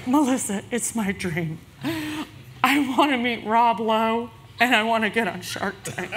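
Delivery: lightly voiced, pleading sweet partner with a laugh in it; Katie voice. Business partner pleading to try Shark Tank, sweet coaxing voice. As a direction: coax her into it with an irresistible plea. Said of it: "in her Katie voice that I just can't resist".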